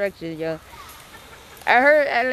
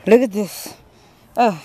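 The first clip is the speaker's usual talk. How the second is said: ordinary talk, nothing marked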